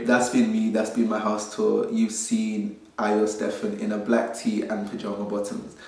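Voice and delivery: normal talking voice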